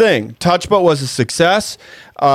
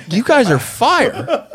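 hyped fan voice; 8 semitones higher, slower than usual. Retold or imitated. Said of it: "they were like".

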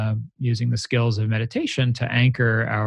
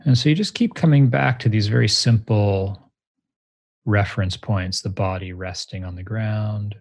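guided meditation. Shown as a demonstration. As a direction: lead a guided meditation, unhurried. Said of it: "let's meditate together right now".

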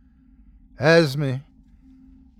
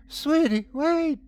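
high-pitched urgent call; high, pleading cry; calling after someone. A narrator's reading. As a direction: call out desperately to a fleeing child. Mother calls after fleeing child.

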